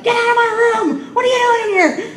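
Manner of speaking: mock yell of an angry patient. Hospital patient yells at the chaplain, high imitated shout. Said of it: "They start yelling at you".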